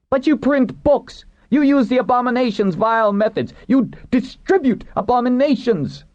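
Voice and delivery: very high, ranting outcry; fanatical accusation. A narrator's reading.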